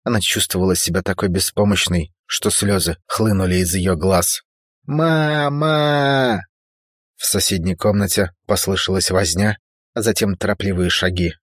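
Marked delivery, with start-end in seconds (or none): 4.8-6.4 high sobbing call for mom; tearful scream. A narrator's reading. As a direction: cry out helplessly, in tears. Crying girl calls mother.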